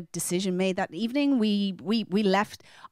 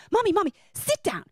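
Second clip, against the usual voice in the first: put-on much higher, urgent child's voice; eager toddler; 12 semitones higher than usual. Two-year-old pulling his mother to sit, eager high call. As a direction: tug at Mommy with your voice.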